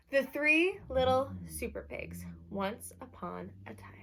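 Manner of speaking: lively read-aloud for a class; bright picture-book reading; reading to children. Acted. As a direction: announce the title like storytime. Teacher reads the title page.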